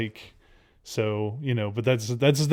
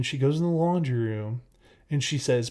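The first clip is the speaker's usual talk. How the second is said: ordinary talk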